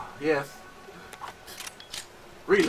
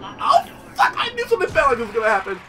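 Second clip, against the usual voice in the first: high, loud frantic shout; hammed-up terror; mock panic; 11 semitones higher, 7 dB louder, faster than usual. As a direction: scream like a doomed victim. Panic at battle.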